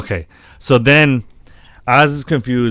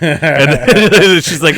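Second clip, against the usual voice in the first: laughing; 6 semitones higher, 5 dB louder, slower than usual. Retold or imitated. Retelling her giving in laughing.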